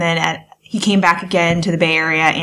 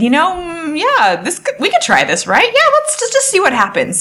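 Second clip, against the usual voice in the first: tentative agreement; 10 semitones higher than usual. Acted. Acting out the partners' tentative decision.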